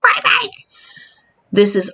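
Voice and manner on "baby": put-on very high squealed "baby"; mock shock. Vlogger acting out walking into a scary room, high mock squeal. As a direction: squeal it in delighted alarm. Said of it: "walk in and go".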